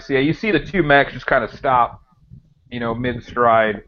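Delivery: usual speaking voice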